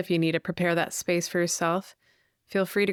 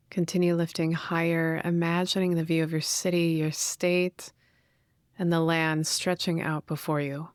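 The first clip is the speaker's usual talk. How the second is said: guided meditation. Real, not acted. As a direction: gently guide listeners inward, leaving space to breathe.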